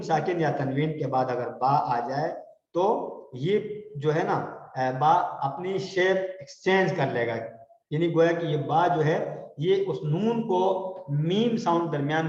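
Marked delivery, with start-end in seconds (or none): none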